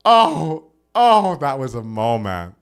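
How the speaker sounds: slow, drawn-out awe; swooning. Real, not acted. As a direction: savor it slowly. Swooning over the ending.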